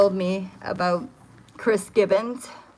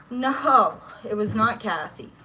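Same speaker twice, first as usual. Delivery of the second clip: ordinary talk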